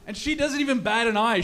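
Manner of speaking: usual speaking voice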